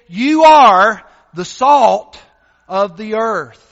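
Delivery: slow, loud, forceful proclamation; proclaimed quote. Acted. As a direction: declare it slowly with authority. Jesus quoted in sermon.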